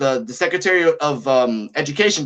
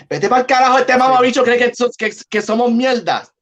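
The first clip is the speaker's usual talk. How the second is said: louder, higher furious Spanish rant; mom's angry outburst; 5 semitones higher, 3 dB louder than usual. Retold or imitated. Retelling his mom cursing the president, louder angry Spanish. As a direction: spit it out like an outraged mother. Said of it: "My mom said".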